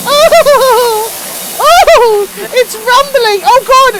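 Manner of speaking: high startled yells; excited yelling. Real, not acted. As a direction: yelp in surprise. Controller rumbling, game chaos.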